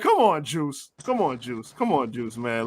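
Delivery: softly exasperated; muttered come on. Real, not acted. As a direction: sigh it out, done with him. Exasperated dismissal.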